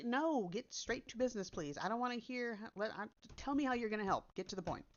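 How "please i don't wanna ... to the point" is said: normal talking voice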